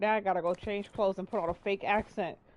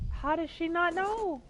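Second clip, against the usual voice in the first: incredulous; 9 semitones higher than usual. Real, not acted. Gamer hearing the body lay for days, incredulous. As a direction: pitch it way up in disbelief. Baffled that body went unnoticed.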